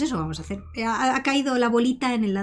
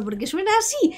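mock imitation; 9 semitones higher than usual. Imitating the odd voice.

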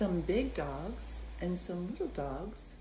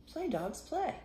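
playful; 11 semitones higher than usual. Acted. Playful contrast with work line.

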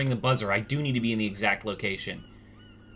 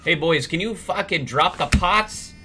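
annoyed yell; 5 semitones higher, 5 dB louder than usual. Acted. Yells at his slow crew.

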